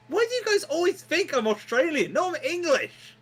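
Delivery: high, loud indignant outburst; re-enacted indignant fit; throwing a fit. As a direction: protest in an exasperated, high-pitched rant. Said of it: "I throw a fit".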